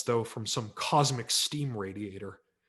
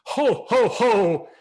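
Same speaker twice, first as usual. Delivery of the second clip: loud, much higher ho ho ho; booming Santa laugh; 10 semitones higher, 12 dB louder, much slower than usual. A narrator's reading. Santa greeting the boy from his throne, booming laugh. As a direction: boom out a big Santa laugh. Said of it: "I heard a booming".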